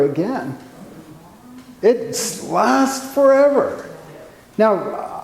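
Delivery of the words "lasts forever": forever drawn out high and slow; emphasis on forever. Real, not acted. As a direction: lean hard on forever. Stressing paint durability.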